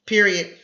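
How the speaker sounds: loud, firm period; marking the stop. Shown as a demonstration. Teacher marking the sentence end, firm and loud. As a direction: call the full stop firmly. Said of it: "Let's stop".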